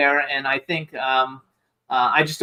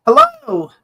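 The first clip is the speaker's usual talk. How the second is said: bright hello; 6 dB louder than usual. Real, not acted. Greeting guest joining call.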